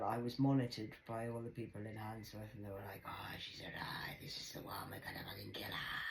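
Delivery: hushed conspiratorial whisper. Retold or imitated. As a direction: whisper it like plotting watchers. Paranoid whispered voices.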